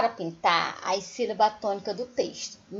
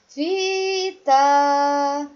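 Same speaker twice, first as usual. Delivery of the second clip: "Fita" half-sung with FI held; sing-song stretch; 3 semitones higher, 5 dB louder than usual. Shown as a demonstration. Teacher showing the capitalised syllable of Fita, sing-song stretch. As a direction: chant "Fita", drawing out both syllables from FI. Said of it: "eu coloquei o fi maiúsculo".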